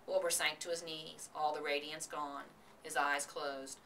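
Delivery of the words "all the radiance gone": usual speaking voice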